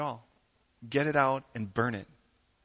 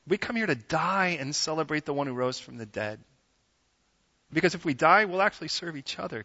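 usual speaking voice

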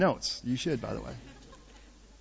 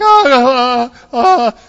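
loud, high mock voice-crack; pubescent cracking voice; 8 semitones higher, 16 dB louder, much slower than usual. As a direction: imitate a pubescent boy's breaking squawk. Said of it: "My voice is cracking".

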